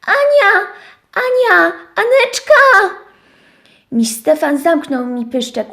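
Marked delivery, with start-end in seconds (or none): 0.1-2.9 wysoko i głośno wołanie; wołaj Anię wysoko i głośno; krzyk. Acted. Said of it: "zaczęłam krzyczeć". (owieczka krzyczy na Anię, wysoko i głośno)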